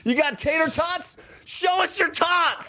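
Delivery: high, slow hammed-up holler; rowdy party voice. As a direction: heckle like a drunk at a party. Joining the rowdy flavor bit.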